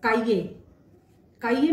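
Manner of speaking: French "cahier", काइये. Shown as a demonstration. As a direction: say काइये clearly, kah-yay. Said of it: "कुछ example देखते हैं।".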